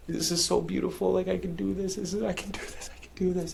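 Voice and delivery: high, hushed pep talk fading into a whisper; self-encouragement. Retold or imitated. Climber urging himself on over and over, hushed self-encouragement. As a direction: breathe it like a private mantra. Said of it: "I was telling myself, you know, over and over".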